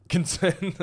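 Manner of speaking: high, loud incredulous echo. Real, not acted. Co-host questions being concerned about the matchup, incredulous. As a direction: throw the word back in disbelief.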